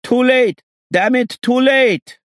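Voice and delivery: very high, loud, slow cry; desperate shout. Acted. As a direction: yell in despair. Same shouter despairing.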